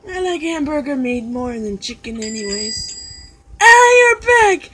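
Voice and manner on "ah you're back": high, very loud, drawn-out cry; shouted greeting. Pretend. Greeting a returning customer, in a long high shout. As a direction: holler it to greet someone returning.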